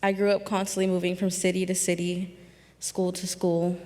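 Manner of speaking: ordinary delivery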